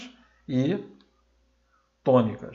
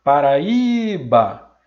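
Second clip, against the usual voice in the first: Paraíba with the Í stretched long and loud; slow, loud Paraíba, leaning hard on the Í; stress on tônica; 8 dB louder, much slower than usual. Shown as a demonstration. Teacher pronouncing Paraíba to find the stressed syllable, Í drawn out. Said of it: "na hora de pronunciar a palavra".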